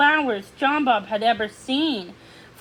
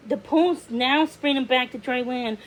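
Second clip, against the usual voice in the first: ordinary delivery, nothing marked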